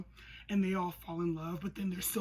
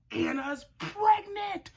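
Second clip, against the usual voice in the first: shocked high reveal; 13 semitones higher, 7 dB louder, slower than usual. Real, not acted. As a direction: squeal the reveal slowly, very high. Dramatic reveal.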